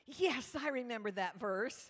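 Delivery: loud, over-confident imitation; enthusiastic youth leader. Youth leader claims to know a made-up verse, enthusiastic. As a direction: burst out as if you truly know it. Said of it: "enthusiastic, but very unenlightened youth leader".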